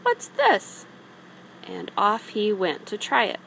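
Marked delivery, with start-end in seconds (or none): 0.0-0.9 much higher, louder surprised cry; startled little Tomten. A narrator's reading. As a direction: squeak it out in surprise. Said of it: "said the Tomten".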